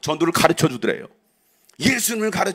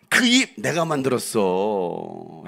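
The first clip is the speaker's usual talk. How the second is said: slow, lower divine answer; lightly voiced God; 7 semitones lower, much slower than usual. God answering Moses' excuse, slow and deep. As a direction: speak as God, calm and certain.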